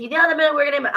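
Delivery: high, loud mock question; mocking imitation. Sick of people asking her this.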